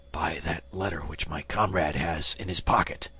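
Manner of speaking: whispered, weakening from blood loss; faint whisper. Acted. Bleeding bandit answers the swordsman in a faint whisper. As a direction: barely breathe the words out.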